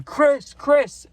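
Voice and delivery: yelling the plug's name so the chasers turn around; yelling his name. Retold or imitated. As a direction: call the name out down the street. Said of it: "And I'm yelling his name".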